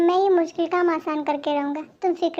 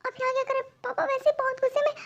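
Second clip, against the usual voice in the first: much higher and softer, a nervous hushed question; hushed panic; 8 semitones higher, 4 dB quieter, faster than usual. Acted. Daughter fears father will catch them, higher hushed panicky question. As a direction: ask again, scared someone will hear.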